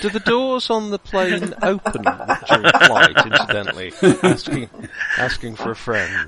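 put-on northern English accent. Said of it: "I did that in a northern accent".

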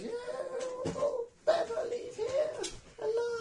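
very high, slow creature voice; squeaky dying demon. Acted. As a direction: squeak out last words while dying. Dying demon's last words.

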